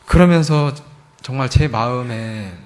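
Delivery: ordinary talk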